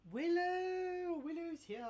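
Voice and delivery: high, drawn-out greeting call. Real, not acted. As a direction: sing out the name in delight. Greets an arrival, called out.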